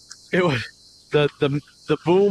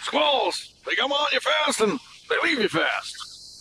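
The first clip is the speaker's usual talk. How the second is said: Captain Ron; 8 semitones higher, 5 dB quieter, slower than usual. Retold or imitated. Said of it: "didn't Captain Ron say".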